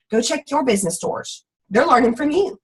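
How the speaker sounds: ordinary talk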